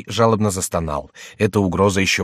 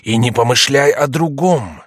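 higher, louder, slow stern warning; angry commandment; 6 semitones higher, 6 dB louder than usual. A narrator's reading. Mysterious voice forbids other loves, angry. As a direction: forbid it slowly and forcefully. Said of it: "с гневом произнёс голос".

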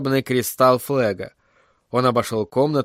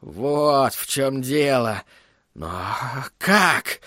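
brooding alarm; slower than usual. A narrator's reading. Villain realising a plot aloud.

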